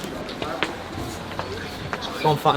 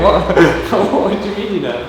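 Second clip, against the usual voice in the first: laughing; 5 semitones higher than usual. Retold or imitated. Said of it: "I'm like".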